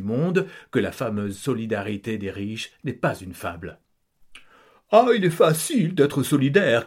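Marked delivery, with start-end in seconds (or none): 4.9-6.9 louder grumbling scorn; grumbling count. Acted. Count griping to family, louder scornful grumble. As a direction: grouse at the poor, disdainful. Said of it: "peste le comte".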